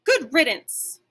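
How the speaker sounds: loud, high barked dismissal; triumphant dog barking good riddance. Acted. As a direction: bark it after him. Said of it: "I'm about to bark".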